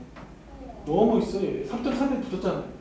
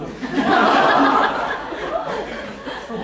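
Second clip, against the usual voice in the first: long unvoiced laugh; breathy laughing fit; burst of laughter; 3 semitones higher than usual. Real, not acted. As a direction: laugh it out at the photo. Laughing at student photo.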